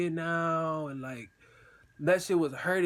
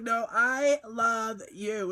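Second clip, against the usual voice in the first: "No, I love you" higher and very drawn out; emphatic one-upping; 5 semitones higher, much slower than usual. Retold or imitated. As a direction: stretch "I love you" to outdo her. Playful one-upping of love declarations.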